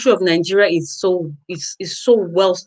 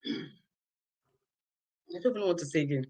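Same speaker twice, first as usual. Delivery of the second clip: overwhelmed murmur; 4 semitones lower, 15 dB quieter, slower than usual. Real, not acted. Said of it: "It's overwhelming".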